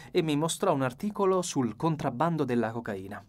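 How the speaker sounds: plain reading voice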